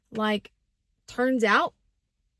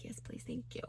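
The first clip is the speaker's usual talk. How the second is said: much softer, unvoiced whisper of relief; meek whisper; 17 dB quieter than usual. Scared patient begging her friend to call her parents, whispering. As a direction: whisper it small and grateful.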